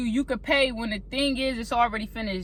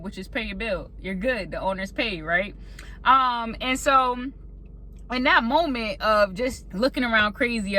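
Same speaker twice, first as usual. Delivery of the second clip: ordinary talk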